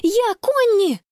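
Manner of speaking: high, urgent call; crying out. A narrator's reading. Sister reaching the lifeguard tower, crying out. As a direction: call out who you are, voice lifted. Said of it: "с трудом переводя дыхание, она крикнула".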